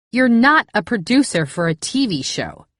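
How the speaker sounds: high, loud indignant accusation; accusing Cass. Acted. Cass confronts the narrator about the fake job, high indignant accusation. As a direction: throw it at her, outraged.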